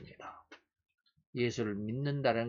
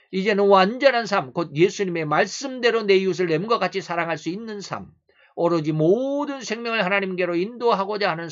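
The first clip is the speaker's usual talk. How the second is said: loud, high, rousing sermon voice; forceful preaching; 7 semitones higher, 11 dB louder than usual. Real, not acted. Preacher urging a complete life on believers, forceful preaching. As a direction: proclaim it with full pulpit force.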